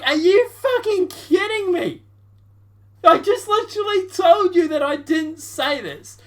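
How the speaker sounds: high, loud, hammed-up outburst as Bernie; mock exasperated Bernie. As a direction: playfully shout it like a fed-up senator. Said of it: "And Bernie is like".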